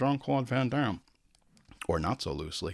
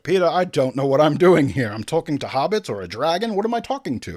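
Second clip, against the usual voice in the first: louder, mock-panicked actor; Ian McKellen breakdown; 3 semitones higher, 6 dB louder than usual. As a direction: pretend to fall apart on set. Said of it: "total nervous breakdown where he's like".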